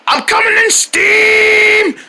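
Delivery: high, slow shouted villain entrance; villain battle cry. Pretend. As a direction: boom it like a cartoon villain. Villain announces his arrival.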